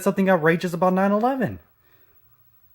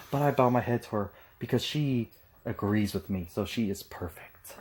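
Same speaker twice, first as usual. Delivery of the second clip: soft mocking mumble; 7 semitones lower, 9 dB quieter than usual. Mocking imitation, trailing soft.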